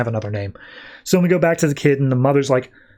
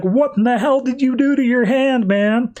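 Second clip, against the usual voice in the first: much higher, incredulous mock yelp; shocked friend; 11 semitones higher than usual. Friend reacting to the broken hand, shocked and much higher. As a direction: imitate a friend who can't believe what he sees.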